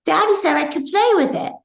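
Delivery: put-on high, bright child's voice, innocent and pleased; little daughter's voice. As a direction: play the five-year-old proudly explaining. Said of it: "And she said".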